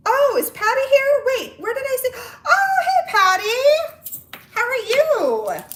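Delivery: loud, very high-pitched squealing hello; real excited greeting. Real, not acted. Host spotting a friend in the live chat, excited. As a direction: burst out high and loud, stretching the last words. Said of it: "my voice tends to get higher and louder when I get excited".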